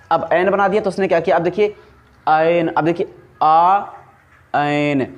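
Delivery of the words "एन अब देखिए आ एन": एन with the tongue up, आ held open, slowly; drawn-out एन and आ; slow sound demo. Shown as a demonstration. Teacher showing students the tongue on एन. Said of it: "जब एन बोल रहे हैं तो हमारा टंग".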